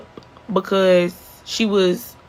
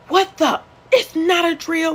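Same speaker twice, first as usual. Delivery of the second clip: high-pitched, incredulous retort; retold disbelief; 10 semitones higher than usual. Retold disbelief at teacher's claim.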